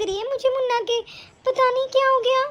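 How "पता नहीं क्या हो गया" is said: usual speaking voice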